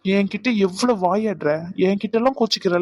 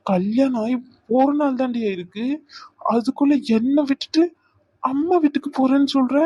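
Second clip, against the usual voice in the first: shocked outburst; 5 semitones higher than usual. Acted. Husband shocked his new wife threatens leaving, rising pitch.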